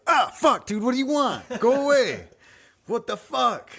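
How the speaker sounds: high, loud freaked-out outburst; startled yell. Retold or imitated. Dad waking to his son staring over the bed, a startled yell. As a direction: jump out of your skin and yell.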